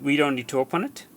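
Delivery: ordinary delivery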